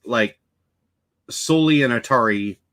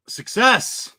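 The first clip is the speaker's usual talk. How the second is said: excited; 9 semitones higher than usual. Real, not acted. Celebrates a success.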